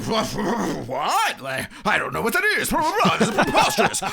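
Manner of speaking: high, loud hammed-up sputtering; blustering offended general. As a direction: harrumph in comic outrage, building to a peak. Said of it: "That's a terrific impression of this entire movie".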